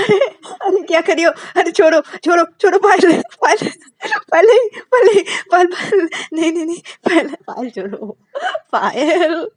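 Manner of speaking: high, loud laughing pleas; being tickled. Acted. Ananya being tickled by Payal, laughing and begging. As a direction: squeal her name while tickled. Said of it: "पायल भी अनन्या को गुदगुदी देने लगती है".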